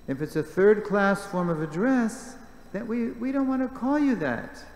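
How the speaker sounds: high, loud, forthright push; blunt insistence. Retold or imitated. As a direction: insist she won't stand for it. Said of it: "she's very straightforward".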